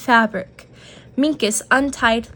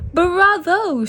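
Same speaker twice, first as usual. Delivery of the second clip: shouting bravo; 8 semitones higher than usual. A narrator's reading. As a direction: cheer the magician on. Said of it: "shouted Mouse".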